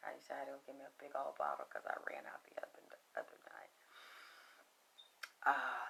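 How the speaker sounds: soft unvoiced whisper, quick in places; hushed ASMR-like murmur. Real, not acted. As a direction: whisper it low as you fuss with the cigar. Whispering while lighting a cigar.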